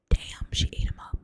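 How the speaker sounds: ordinary delivery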